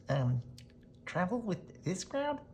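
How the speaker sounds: high, amused rabbit voice; lightly voiced amused rabbit; rabbit laughing. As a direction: scoff it with a chuckle like a rabbit. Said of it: "the rabbit said with a laugh".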